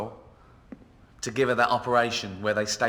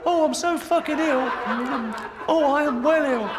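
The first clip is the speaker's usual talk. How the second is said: much higher, louder whine; mock whiny voice; 12 semitones higher, 5 dB louder than usual. Comedian mimics the obese woman with a high, loud whine. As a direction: whine like a self-pitying patient. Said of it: "mock whining voice".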